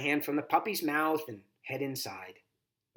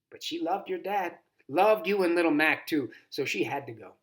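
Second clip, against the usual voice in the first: ordinary delivery, nothing marked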